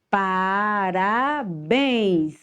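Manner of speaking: Parabéns drawn out slowly, syllable by syllable; teacher's very slow demo of Parabéns; stress on BÉNS. Shown as a demonstration. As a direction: stretch Parabéns, landing on BÉNS. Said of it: "o bens".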